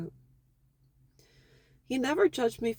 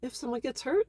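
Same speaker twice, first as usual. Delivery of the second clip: ordinary talk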